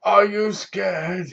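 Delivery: slow, drawn-out menacing taunt, fading to a hush; slow taunting killer, dropping almost to a whisper; mock creepy killer voice. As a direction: play the masked killer teasing his victim. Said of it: "with the gun like this".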